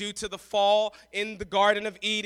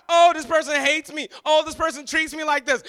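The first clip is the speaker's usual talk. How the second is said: mocking whine; 6 semitones higher, 10 dB louder than usual. Mocking self-pity quote.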